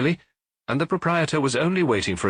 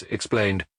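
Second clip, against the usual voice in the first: ordinary delivery, nothing marked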